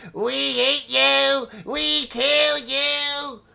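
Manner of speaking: high, strange, slow creature chant; eerie creature voice. Acted. Creature warns the party off, high menacing voice. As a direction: threaten like a twisted beast. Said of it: "another voice comes from the same direction".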